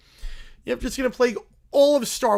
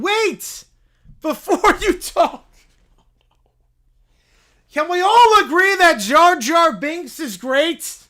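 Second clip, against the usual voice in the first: loud, gushing fan; excited fan; 7 semitones higher, 11 dB louder, slower than usual. Acted. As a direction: rave about Jar Jar Binks. Bear gushes about Jar Jar.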